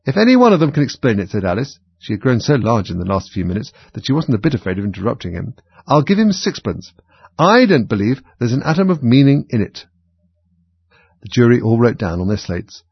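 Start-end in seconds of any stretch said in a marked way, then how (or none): 0.1-1.3 quick, higher, louder interruption; excited. A narrator's reading. Alice interrupts. 5.9-9.9 put-on much higher, louder, scornful girl; defiant. A narrator's reading. Alice defiant.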